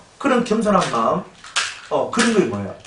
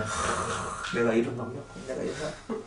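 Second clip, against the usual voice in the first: 숨죽여 울먹이며 나직이 고백하는 노인; 흐느끼듯 아주 작게 속삭이는 회개; 5 semitones lower, 10 dB quieter than usual. Retold or imitated. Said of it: "눈물로 그냥". (설교 듣고 깨진 노인이 작게 우는 듯이)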